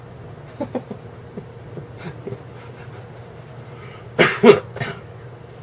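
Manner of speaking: high breathy laugh. Real, not acted. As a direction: laugh at own joke. Joke about Stephen King.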